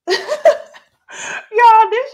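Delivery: talking through big laughs, high and loud; slow, laugh-soaked shout of delight; laughing hard. Real, not acted. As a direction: crack up while you get the words out. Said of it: "I'm laughing because".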